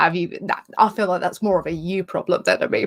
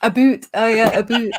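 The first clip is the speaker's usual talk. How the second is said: louder, higher mock-Canadian "boot"; Canadian accent; 4 semitones higher, 5 dB louder than usual. Shown as a demonstration. Guest copying the host's word, playful Canadian accent. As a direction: laugh into an exaggerated Canadian "boot". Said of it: "Do a Canadian accent".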